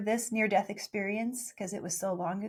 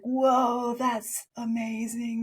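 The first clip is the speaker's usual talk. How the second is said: loud whoa, then slow amazement; amazed whoa; 4 dB louder, much slower than usual. Retold or imitated. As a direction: marvel at it slowly. Child amazed by seraphim.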